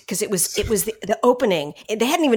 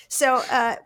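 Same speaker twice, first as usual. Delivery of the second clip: usual speaking voice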